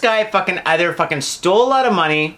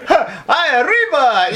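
Italian; 7 semitones higher, much slower than usual. Pretend. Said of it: "What if it was Italian?".

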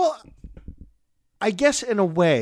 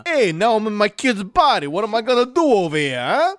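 higher-pitched New York tough-guy patter; Andrew Dice Clay; 5 semitones higher than usual. Acted. Dad stuck in his kid's body, mock New York wise-guy voice. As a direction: play it as a cocky wise guy. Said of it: "I love Andrew Dice Moore".

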